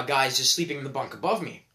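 ordinary talk, nothing marked

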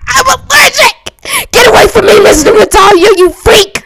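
high, loud alarmed yelling; panicked shout. Acted. Player shrieking at Mister Matalia about peanuts, panicked high shout. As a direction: shove him away with a shriek.

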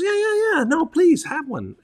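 high, coaxing voice of a kind local woman; insisting island lady. Retold or imitated. Local woman pressing a conch on a visitor, high and coaxing. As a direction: press the gift on him, high and warm.

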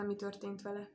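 usual speaking voice

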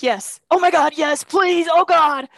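high, loud pleading gush; desperate relief. Retold or imitated. Freezing man begs Andrea for shelter, pleading relief. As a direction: beg with sudden relief.

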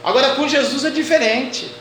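usual speaking voice

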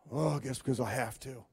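soft, grudging resignation; reluctant sigh. Retold or imitated. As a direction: sigh it out unwillingly. Reluctant giver voiced.

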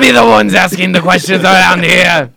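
explosive loud, high punchline; hammed-up interrogator yell; mock KGB shout. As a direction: bellow it like a bad KGB interrogator.

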